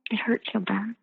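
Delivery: a broken whisper; barely voiced, aching; whispered hurt. Real, not acted. Tearful confession of heartbreak.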